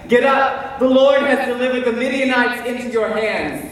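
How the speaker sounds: high, urgent call to the camp; rousing call. Acted. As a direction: rouse the sleeping troops. Gideon rouses his camp.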